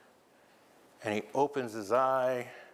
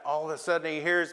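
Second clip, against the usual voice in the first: ordinary delivery, nothing marked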